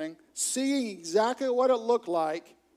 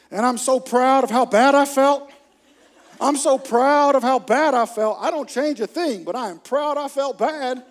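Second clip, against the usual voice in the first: loud, higher hammed-up pride; exaggerated, high-energy bragging; mock-proud boasting; 5 semitones higher, 10 dB louder than usual. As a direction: crow about it as a self-satisfied churchgoer. Churchgoer boasts of feeling bad.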